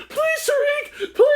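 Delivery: very high, drawn-out whimpering plea; mock begging. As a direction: beg for your life, hammed up. Acting out victim begging.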